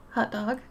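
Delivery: "hot" said like "hut"; "hut dog" pronunciation. Shown as a demonstration. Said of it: "H U T".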